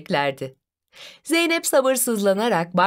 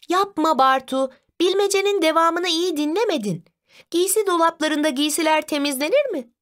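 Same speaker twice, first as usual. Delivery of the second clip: higher-pitched sisterly protest; lightly voiced Zeynep objecting; 8 semitones higher than usual. Sister tells brother he didn't listen, mild high-pitched protest. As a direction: correct him in a lightly raised child's voice.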